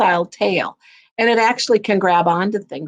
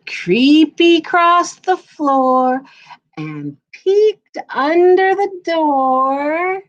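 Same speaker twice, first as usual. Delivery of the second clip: slow, drawn-out creeping words; creeping slow narration; 8 semitones higher, 3 dB louder, much slower than usual. A narrator's reading. Storyteller to children, creeping to the door slowly.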